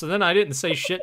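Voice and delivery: ordinary delivery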